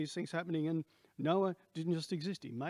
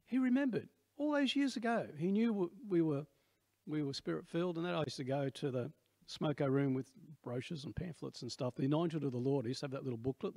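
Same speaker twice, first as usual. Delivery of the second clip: ordinary delivery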